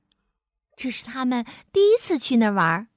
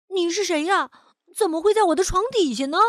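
higher, quickening questions; puzzled surprise; 5 semitones higher, faster than usual. A narrator's reading. Louise questions the monster under the bed, puzzled. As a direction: ask in a high, baffled child's voice. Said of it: "路易斯觉得很奇怪".

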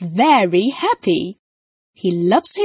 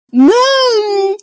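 put-on high, loud delighted toddler shout; excited cry; 11 semitones higher, 6 dB louder than usual. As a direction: shout it out with glee. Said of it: "cries George".

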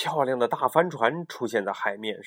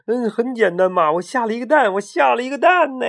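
high, loud proud boasting; delighted; 10 semitones higher, 6 dB louder than usual. Acted. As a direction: crow about the first egg. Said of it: "他特别高兴的".

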